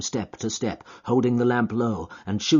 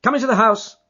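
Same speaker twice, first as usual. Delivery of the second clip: crisp and firm; 13 semitones higher, 8 dB louder, faster than usual. Acted. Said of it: "in his crisp, firm way".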